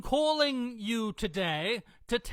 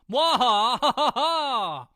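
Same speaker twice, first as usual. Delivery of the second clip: loud slow evil cackle; evil laugh; 7 dB louder than usual. Pretend. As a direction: cackle like a cartoon villain. Villain laugh.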